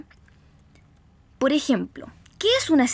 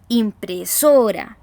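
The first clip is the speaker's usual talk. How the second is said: impresora slowly, SO loud and drawn out; stress on SO; 2 semitones lower, 6 dB louder, much slower than usual. Shown as a demonstration. Teacher showing where impresora carries the voice, heavy stress on SO. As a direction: lean hard on the SO in impresora. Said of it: "la voz se carga en esa sílaba".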